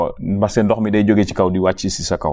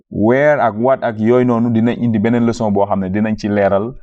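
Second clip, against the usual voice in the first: usual speaking voice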